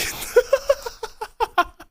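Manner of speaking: high-pitched burst of laughter; high wheezing laugh. Real, not acted. Laughing at the punchline of his own story, high wheeze. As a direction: crack up at your own story.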